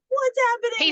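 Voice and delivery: high-pitched mock bewilderment; alarmed chaperone. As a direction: imitate a startled woman, voice jumping up. Chaperone kissed by waking drunk.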